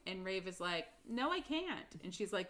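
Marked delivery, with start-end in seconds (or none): 1.1-2.0 high-pitched quoted denial; retold protest. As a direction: imitate a character protesting, pitched up.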